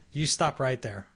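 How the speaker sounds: plain reading voice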